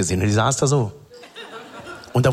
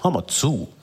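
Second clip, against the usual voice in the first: secretive mechanic; 4 semitones higher, much slower than usual. Retold or imitated. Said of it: "immer so zu sprechen, als ob alles ein krasses Geheimnis ist".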